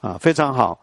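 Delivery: ordinary talk, nothing marked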